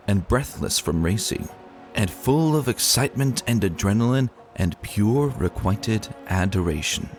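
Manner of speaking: normal talking voice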